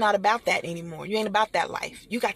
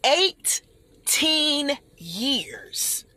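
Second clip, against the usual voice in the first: eighteen years stretched very slowly, higher; dragged-out emphasis; 6 semitones higher, much slower than usual. Real, not acted. Speaker stressing her long wait, stretched slowly.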